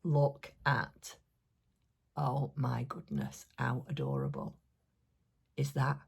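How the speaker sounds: ordinary talk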